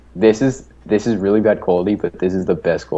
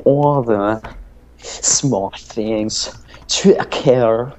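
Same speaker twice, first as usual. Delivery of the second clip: drawn-out, raised pop-punk frontman voice; 3 semitones higher, much slower than usual. Acted. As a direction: stretch the vowels in an odd put-on singer's accent. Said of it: "that's my [name]".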